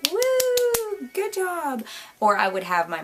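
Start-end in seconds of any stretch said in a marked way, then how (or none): 0.1-1.8 very high, mocking "woo"; high, fake-cheery sarcastic praise; sarcastic cheer. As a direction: tease herself as viewers would. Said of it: "I know, you're like".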